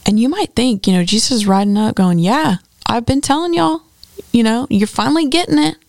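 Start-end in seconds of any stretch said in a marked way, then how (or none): 2.2-5.8 higher, playful told-you-so voice; mock smug boasting. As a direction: tease them like you called it all along. Imagined Jesus gloating while riding.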